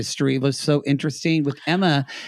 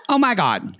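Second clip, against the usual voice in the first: lightly voiced campy exclamation; a hint of flamboyant imitation; queeny producer; 5 semitones higher than usual. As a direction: echo the queeny producer, a little higher. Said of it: "but he queened the fuck out".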